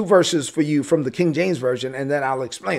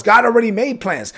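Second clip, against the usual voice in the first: ordinary delivery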